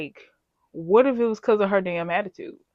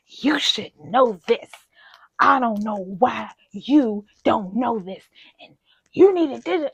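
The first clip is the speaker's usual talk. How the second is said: imitating boss yelling; 2 semitones higher, 4 dB louder, slower than usual. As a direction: play the condescending supervisor. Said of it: "the way she yelling at me".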